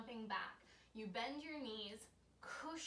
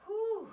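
breathy exhale; 12 semitones higher, 14 dB louder than usual. Real, not acted. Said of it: "excuse my breathing".

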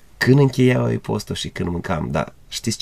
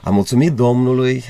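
ordinary delivery

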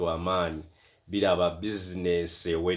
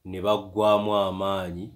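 plain reading voice

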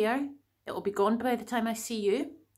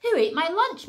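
high, loud indignant shout; outraged farmer shouting across the yard; 8 semitones higher, 6 dB louder than usual. Acted. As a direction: yell it like a farmer whose lunch is gone. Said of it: "Mister Potts shouted out".

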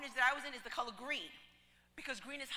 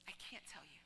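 whispered secret; 7 semitones lower, 13 dB quieter than usual. Retold or imitated. Shy girl keeping a secret.

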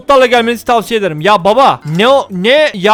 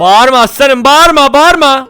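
louder and higher, scolding; telling off; 3 semitones higher, 5 dB louder, slower than usual. Real, not acted. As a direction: shout him down to stop shouting. Telling a shouting customer to stop. (bağıran müşteriyi yüksek sesle azarlayarak)

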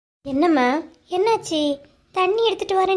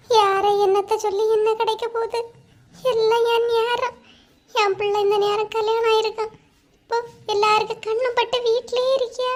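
distressed lament; 5 semitones higher than usual. Acted. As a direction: lament your bad luck in a raised pitch. Mother laments her child's stalled wedding. (புலம்பும் குரலில்)

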